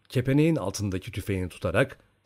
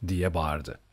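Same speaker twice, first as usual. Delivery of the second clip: ordinary delivery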